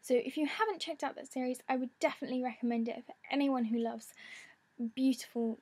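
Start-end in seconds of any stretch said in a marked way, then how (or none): none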